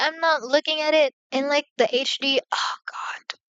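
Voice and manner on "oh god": whispered, voiceless "oh God"; hushed dismay. Real, not acted. Reacting to the video in HD, whispered dismay. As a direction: whisper it to yourself in dread. Reacting to what she sees.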